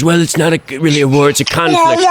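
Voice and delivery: Trump. Retold or imitated. Host quoting the president's excuse, mocking impression. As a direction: wriggle out of it in a put-on voice. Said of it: "I need to update my Trump impression".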